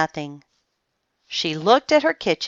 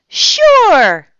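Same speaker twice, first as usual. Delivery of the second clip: put-on a high, loud childlike "Sure"; eager children; 12 dB louder than usual. A narrator's reading. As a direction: chirp it like keen kids. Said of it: "said the children".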